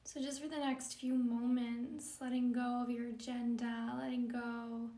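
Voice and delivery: calm, measured yoga-teacher meditation delivery; guided meditation. Acted. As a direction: guide them gently into stillness, leaving space between phrases.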